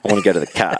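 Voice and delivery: daughter mimicking Australian "car" without the R; mock Aussie accent. Daughter makes fun of dad's word, mock Australian accent. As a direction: tease with an Aussie "cah". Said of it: "makes fun of it".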